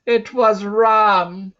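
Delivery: high, slow, loud joyful shout; excited cry. A narrator's reading. Narrator reading the moment the dog appears, excited cry. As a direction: call it out with relief. Boy spots his dog in dust.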